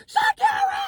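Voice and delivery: high shouted name with a laugh. Retold or imitated. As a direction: shriek the name in delight. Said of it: "She said".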